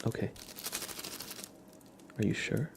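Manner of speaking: ordinary talk, nothing marked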